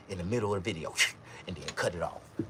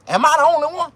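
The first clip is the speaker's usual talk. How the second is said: high, loud despairing cry; desperate outcry; 14 dB louder, faster than usual. Retold or imitated. Crying out about wasting money. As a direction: throw the question up in despair. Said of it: "I was like".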